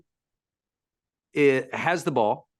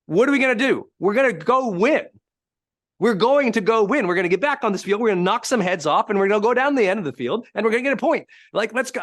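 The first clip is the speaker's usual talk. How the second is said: raised-pitch pep talk in imitation; assertive coach; 6 semitones higher, 3 dB louder than usual. Coach rallying the team for the next play, acted assertive. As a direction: drive each promise home like a coach. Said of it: "how he got so assertive in it".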